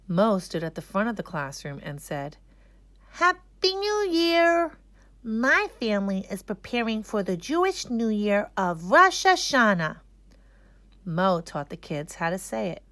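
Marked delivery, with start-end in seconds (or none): 3.1-10.0 put-on excited kid. A narrator's reading. Mo greets his class for Rosh Hashanah, excited.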